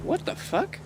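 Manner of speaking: high, shocked disbelief; incredulous high What the fuck; surprised. Real, not acted. Reacting to chat saying rage bait.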